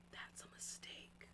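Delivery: slow, menacing whisper. Real, not acted. Whispering a warning to people who underestimate her. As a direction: breathe it as a quiet threat. Ominous aside about Bree's size.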